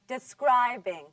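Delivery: "Describing" with a strong, held middle syllable; stress on second syllable. Shown as a demonstration. Teacher models for class to repeat, "Describing" stressed on the second syllable. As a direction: hit the SCRIB in "Describing". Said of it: "Now repeat after me".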